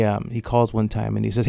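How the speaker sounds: ordinary talk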